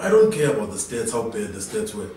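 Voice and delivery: ordinary talk, nothing marked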